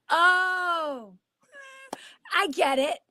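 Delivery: high, laughing "oh, I get it"; knowing laugh. Retold or imitated. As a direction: play the knowing, amused listener. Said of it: "it's like they go".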